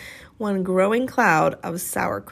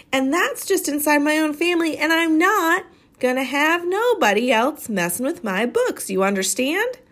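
put-on young girl laying down the law, higher; fierce girl scolding; 6 semitones higher than usual. Amanda warning the bully off her books, fierce girl scolding. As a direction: Amanda telling off a bully, daring him to argue.

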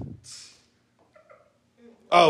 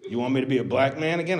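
ordinary talk, nothing marked

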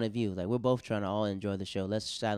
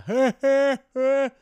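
mock deep heckler laugh; 12 dB louder, much slower than usual. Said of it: "Just, like, yelling, like, deep laugh".

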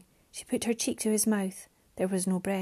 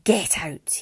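restrained, hard-edged shouted order; angry shout; 3 semitones lower, 3 dB louder than usual. A narrator's reading. Father orders Mariana to leave, angry shout. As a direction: order her out, forceful but not full volume. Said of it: "he shouted".

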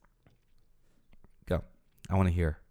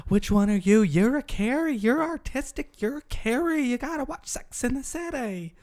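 much higher, excited gushing voice. Retold or imitated. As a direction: gush like a fan pushing the show.

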